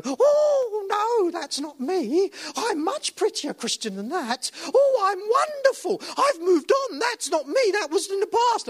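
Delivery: high, affronted denial of the mirror. Retold or imitated. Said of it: "And we go".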